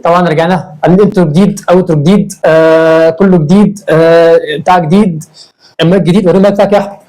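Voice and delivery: loud, fast upbeat host greeting; energetic vlog intro. Acted. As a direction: hype up the audience like a YouTube host.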